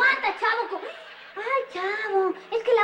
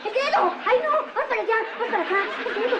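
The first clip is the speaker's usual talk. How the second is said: frantic yelling; 4 semitones higher than usual. Acted. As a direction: shout in a panic. Otra vez la olla se sale. (gritos desesperados)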